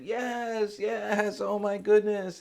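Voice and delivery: high, loud, elated outburst; overjoyed. Acted. Happiest man answers the seeker at his door, overjoyed. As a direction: burst out with drawn-out, giddy delight. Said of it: "he's just so happy and laughing".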